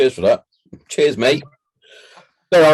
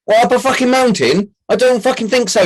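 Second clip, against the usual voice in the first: incredulous scoff, pitched much higher; scornful disbelief; 11 semitones higher than usual. Retold or imitated. Soldier mocking the officer's IED excuse, high incredulous scorn. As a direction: throw his excuse back at him, higher and scornful.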